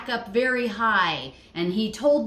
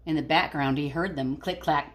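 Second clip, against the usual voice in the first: put-on lively read-aloud voice for kids; storybook reading; 3 semitones lower, 4 dB louder than usual. Teacher reading a picture book to her class, lively.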